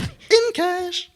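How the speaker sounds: high, loud mock catchphrase; Basher impression. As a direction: belt it out in a hammed-up character voice. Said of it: "I love Basher in this scene".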